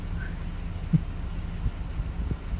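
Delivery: laughing. Real, not acted. Laughing after song.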